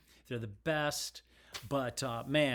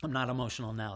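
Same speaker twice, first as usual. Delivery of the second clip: normal talking voice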